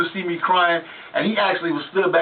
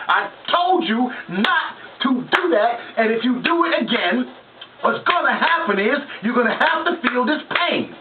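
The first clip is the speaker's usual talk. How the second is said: preaching dad; 7 semitones higher than usual. Retold or imitated. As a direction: lecture the child through the whipping. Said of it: "he was giving you a sermon".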